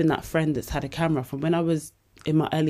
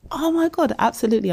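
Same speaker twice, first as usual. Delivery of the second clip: excited yes; 9 semitones higher, 4 dB louder, faster than usual. Real, not acted. Invited to speak at school.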